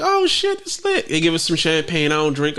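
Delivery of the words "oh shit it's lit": high excited voice. Retold or imitated. Retold amazement at resort.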